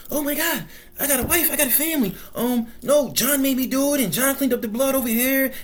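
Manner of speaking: high-pitched mock panic; panicked snitch. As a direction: plead in a high voice like a coward caving in. Acting out cowards snitching under indictment.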